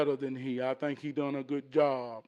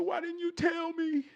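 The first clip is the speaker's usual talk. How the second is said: upset son; 12 semitones higher than usual. Acted. Son asking father why he hid it, upset. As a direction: cry it out in a high boy's voice.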